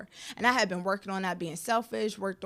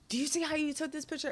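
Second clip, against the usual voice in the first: lightly imitated Russian accent, pitched up; 5 semitones higher, faster than usual. Retelling the husband's complaint about a photo, light Russian accent. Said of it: "in his Russian accent".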